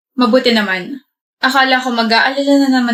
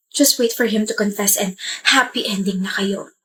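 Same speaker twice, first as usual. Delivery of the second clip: usual speaking voice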